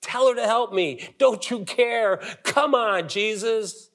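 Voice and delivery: high, pleading complaint as Martha; frustrated Martha begging for help; Martha confronting Jesus. Acted. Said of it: "Martha confronts Christ".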